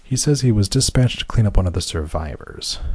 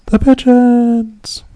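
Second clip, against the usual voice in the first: loud, high, drawn-out yell; mock yell; 7 dB louder, much slower than usual. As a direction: holler it out high, stretching the last word. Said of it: "[name] yells".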